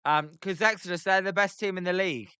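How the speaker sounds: ordinary delivery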